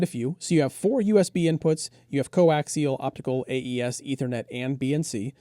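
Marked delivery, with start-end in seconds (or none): none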